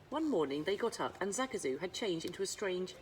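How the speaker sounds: ordinary delivery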